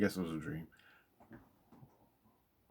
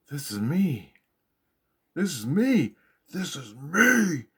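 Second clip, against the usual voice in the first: awed revelation; 6 semitones higher, 4 dB louder than usual. Retold or imitated. Dream revelation repeated.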